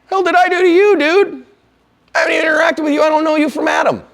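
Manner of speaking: indignant shout. Retold or imitated. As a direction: shout it back, baffled and offended. Retorting to the driver.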